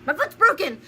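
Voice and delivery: panicked rush. Retold or imitated. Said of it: "I was like".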